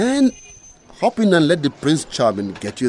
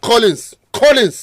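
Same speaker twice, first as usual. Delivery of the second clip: angry call; 6 semitones higher, 4 dB louder than usual. Acted. Angry student union quarrel.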